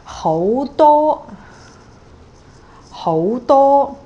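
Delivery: hou rising, dou high and level, said high and loud; rising then high tone. Shown as a demonstration. Teacher models hou dou, rising then high. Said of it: "it's two and one".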